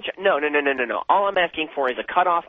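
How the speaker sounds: ordinary talk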